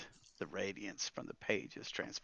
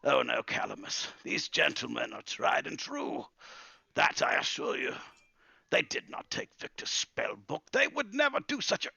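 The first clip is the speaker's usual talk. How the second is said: put-on louder, protesting old man; old wizard, insistent; 6 semitones higher, 5 dB louder than usual. Old wizard assuring Calamus the party is true, insistent. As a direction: firmly vouch in an old wizard's voice.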